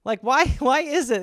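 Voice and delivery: normal talking voice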